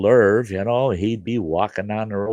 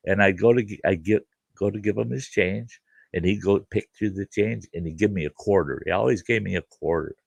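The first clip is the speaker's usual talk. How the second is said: ordinary delivery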